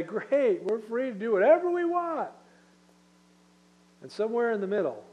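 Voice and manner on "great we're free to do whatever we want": mock liberal glee. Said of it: "the liberals that would say".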